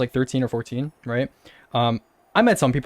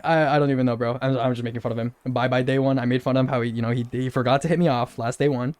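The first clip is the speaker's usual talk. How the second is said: ordinary delivery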